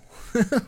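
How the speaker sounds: a quick high burst of laughter; short laugh. Real, not acted. Laughing at the band's bad albums. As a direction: laugh at your own joke.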